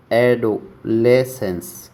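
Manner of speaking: एडोलेसेंस said very slowly, each syllable apart; teacher stretching एडोलेसेंस for students; slow pronunciation demo. Shown as a demonstration. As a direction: spell out एडोलेसेंस slowly by sound. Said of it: "कैसे याद करना इसको?".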